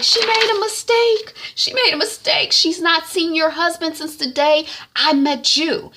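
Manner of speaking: high, loud pleading; pleading defence. Retold or imitated. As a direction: beg the wife for mercy. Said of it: "T Y is like".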